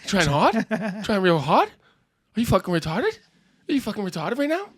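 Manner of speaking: high, loud bro scolding; mock-incredulous tough guy. Comic in character berating his cohost for trying hard, mock outraged.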